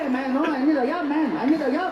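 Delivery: high, pleading street-casual voice; parking lot voice. Acted. Applicant after the interview, high desperate casual plea. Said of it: "then you get to the parking lot".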